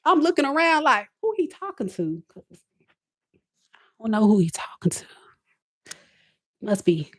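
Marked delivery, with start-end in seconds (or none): 1.2-7.1 petty. Retold or imitated. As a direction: act like you have no idea who he means. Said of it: "this is me, I'm so petty".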